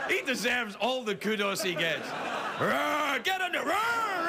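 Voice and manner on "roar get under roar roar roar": much higher, louder shouted roars; comic bear roaring at the dryer; mock bear roar. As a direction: play a squashed bear protesting. Bear forced into spin dryer.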